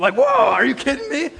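loud, high astonished outburst; stunned disbelief. Retold or imitated. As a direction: blurt it like the twist floored you. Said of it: "reacting to movie twist".

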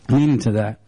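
low repeat. Real, not acted. Repeats it lower to sink in.

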